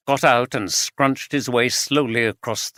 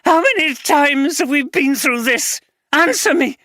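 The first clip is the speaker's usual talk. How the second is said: much higher, louder furious shout; wife shouting back at husband; 5 dB louder than usual. Acted. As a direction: throw his words back at him.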